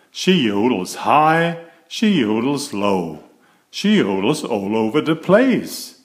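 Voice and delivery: lively, bright storybook read-aloud; reading to children. A narrator's reading. Narrator reading a picture book aloud to young children, animated storytelling. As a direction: read it like bedtime story time for little ones.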